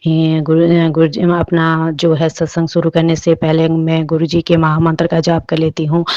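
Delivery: loud, fervent greeting to the guru; loud devotional opening. Acted. Devotee opening satsang for the congregation, loud and fervent. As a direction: call out praise to the guru at full voice.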